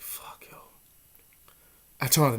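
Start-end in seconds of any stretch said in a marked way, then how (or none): 0.0-0.6 breathy whisper. Retold or imitated. Scared alone in his room, whispered. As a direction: whisper it to yourself in dread.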